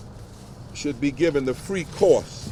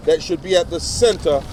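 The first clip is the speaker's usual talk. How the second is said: rising oratory, paced and emphatic, a touch louder; formal speech; 3 semitones higher, 3 dB louder than usual. Acted. Reverend reading an open letter to the press, in formal oratory. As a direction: preach the letter like a pulpit address.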